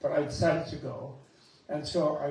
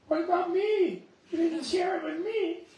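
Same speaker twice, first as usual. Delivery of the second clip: high, whiny "what about me"; jealous pout, much higher; mock jealous whine; 14 semitones higher, 3 dB louder than usual. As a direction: act out a jealous child, pitched way up. Acting out a jealous person.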